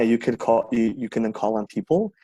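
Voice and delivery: normal talking voice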